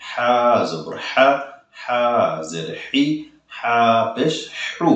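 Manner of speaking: slow, drawn-out হা with throat squeeze; pressed throat h. Shown as a demonstration. Teacher modelling the letter slowly, throat-pressed h. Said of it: "গলায় চাপ দিয়ে বলতে হবে".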